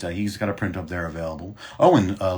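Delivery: ordinary talk, nothing marked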